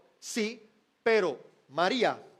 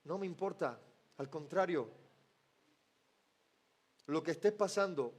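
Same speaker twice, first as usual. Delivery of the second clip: much softer and lower, hushed and tender; intimate voice of Jesus; 7 semitones lower, 8 dB quieter than usual. Acted. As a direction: speak close like a comforting whisper. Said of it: "Jesús le podría decir". (Jesús al que sufre, voz muy suave y tierna)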